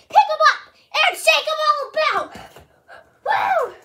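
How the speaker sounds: high, loud and excited; excited shout. Real, not acted. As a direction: shout it out with glee. Excited about shaking dog.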